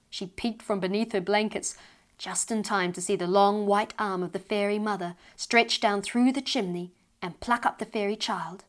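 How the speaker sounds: usual speaking voice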